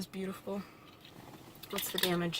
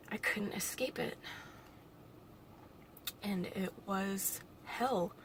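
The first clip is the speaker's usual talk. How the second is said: hushed whisper; 8 dB quieter, slower than usual. Real, not acted. Painful bullying memory.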